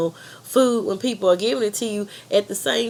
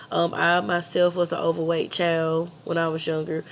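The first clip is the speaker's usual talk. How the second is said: normal talking voice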